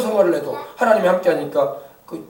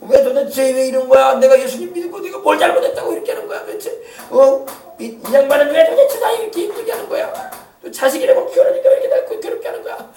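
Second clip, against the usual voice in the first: hammed-up grumbling, pitched way up; mock complaining; 7 semitones higher than usual. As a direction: play up an exasperated whine of "why me?". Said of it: "그때 막 불평하고".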